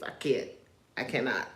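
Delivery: normal talking voice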